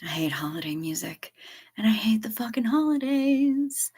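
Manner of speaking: whispered grumble. Real, not acted. Confessing to the stream, whispering. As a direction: hiss it like a secret gripe.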